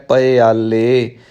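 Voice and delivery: ਪਿਆਲੇ slowly as "pa-e-aalle"; open first syllable. Shown as a demonstration. Teacher correcting learners, ਪਿਆਲੇ drawn out as "pa-e-aalle". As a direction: keep "pa" open and separate in ਪਿਆਲੇ. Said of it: "ਈੜੀ ਨੂੰ ਸਿਹਾਰੀ ਲਾ ਕੇ ਆਪਾਂ ਪਾਠ ਕਰਨਾ ਹੈ".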